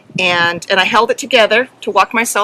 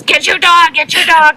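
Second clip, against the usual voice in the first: judge's command; 7 semitones higher, 4 dB louder than usual. Retold or imitated. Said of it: "when the judge says".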